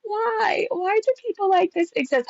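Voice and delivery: high wail of disbelief; drawn-high, despairing disbelief; high-pitched dismay. Real, not acted. Dismayed reaction to thread.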